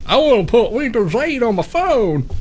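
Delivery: mock dimwit voice. Host playing someone foolish about the phone, mock dimwit voice. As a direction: put on a silly high dimwit voice.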